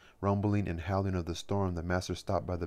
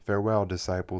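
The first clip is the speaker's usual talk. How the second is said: ordinary delivery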